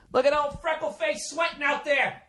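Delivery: high, sneering taunt; mocking heckler. Fan mocking a player at the foul line, high sneer. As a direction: jeer at the player from the stands.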